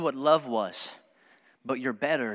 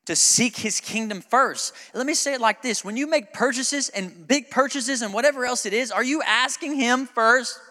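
higher, slower preaching push; preaching build; 8 semitones higher than usual. Acted. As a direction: press the question on the room. Preaching rising intensity.

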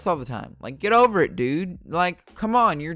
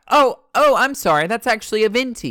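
polite correction; 8 semitones higher, 9 dB louder than usual. Pretend. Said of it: "you could be like".

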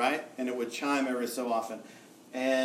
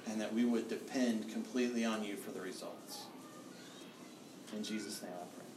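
soft, lower pastoral prayer voice; closing prayer; 3 semitones lower, 8 dB quieter than usual. Acted. Pastor prays over the congregation, soft and fading. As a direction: pray gently over the congregation, fading to near silence.

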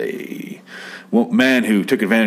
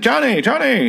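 high-pitched mock excited call. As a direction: imitate someone thrilled to spot a friend. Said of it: "he's all excited".

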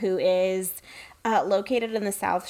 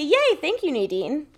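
bright little yay, much higher; chirpy gratitude; 6 semitones higher than usual. Retold or imitated. Thanking the shelter lady with an overly chirpy yay. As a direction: gush thanks to win her over. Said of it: "So I say".

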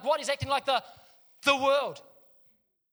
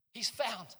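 usual speaking voice

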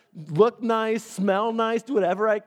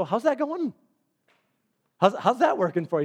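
higher, dry mock question; sarcastic; 6 semitones higher than usual. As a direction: needle him with a knowing question. Pastor's sarcastic reply retold.

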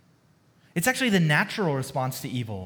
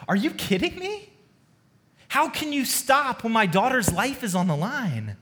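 a hint of an upset father pleading; exasperated Jairus; 6 semitones higher than usual. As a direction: pitch jumps high in disbelief at the start. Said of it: "Jairus must be thinking".

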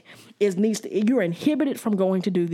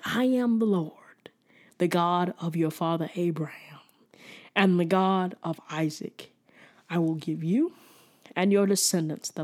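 hushed voice of God; 2 semitones lower than usual. Acted. God speaks in Jacob's dream.

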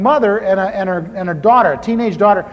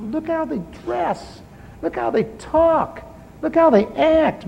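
mocking disdain; 7 semitones higher, 6 dB quieter than usual. Mocking church people.

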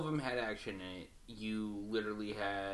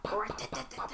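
minigun pop noises; 13 semitones higher than usual. Retold or imitated. Imitating minigun fire.

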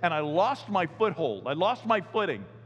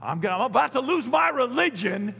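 higher and louder, a parent at the end of their rope; exasperated parent warning; 7 semitones higher, 5 dB louder than usual. Retold or imitated. Parent warning noisy kids, exasperated and loud. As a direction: warn the kids like a fed-up parent. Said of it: "parents will say that to kids".

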